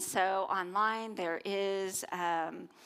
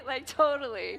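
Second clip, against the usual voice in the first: put-on a light Valley girl lilt; 5 semitones higher, 4 dB louder than usual. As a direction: toss it off like a teen Valley girl. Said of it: "I sound like a Valley girl".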